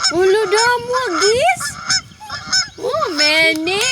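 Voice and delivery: put-on high, loud delighted question to the toddler; thrilled and playful, sing it high; playful excitement. Mom excited by geese sound.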